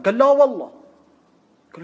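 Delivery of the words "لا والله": no way, said loud and high; إصرار عالٍ. Retold or imitated. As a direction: insist loudly, refusing to be turned down. البرمكي يصر بصوت عال. (رفض بصوت مرتفع وحاد)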